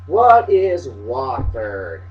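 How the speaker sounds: very low, soft, drawn-out "Wofford"; savoring the word. Real, not acted. Looking up Wofford and enjoying the name, savoring it. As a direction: roll "Wofford" around slowly and quietly. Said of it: "That's fun to say".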